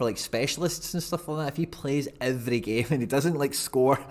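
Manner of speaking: normal talking voice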